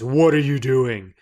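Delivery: a mild mock yell; retold yell. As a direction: echo the player's shout back at the table. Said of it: "You yell at, in his face".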